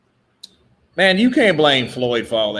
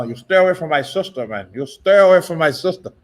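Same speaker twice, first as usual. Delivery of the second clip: Scarface impression. Mock Tony Montana warning off a man from his sister. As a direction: warn him off your sister like Tony Montana. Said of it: "That's Scarface".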